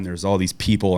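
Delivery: ordinary talk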